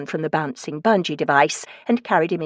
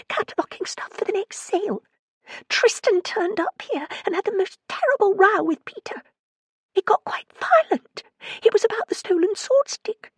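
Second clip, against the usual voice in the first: frightened voice. A narrator's reading. Frightened caller on phone.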